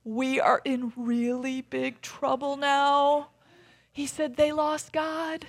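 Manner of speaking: put-on high, slow, gasping child's confession; alarmed little boy. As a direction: play a scared kid short of breath, drawing it out. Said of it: "he starts gasping for breath".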